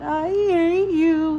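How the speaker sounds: put-on high, slow, drawn-out boy's howl; wailing child's howl. A narrator's reading. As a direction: howl it through tears. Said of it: "he howled".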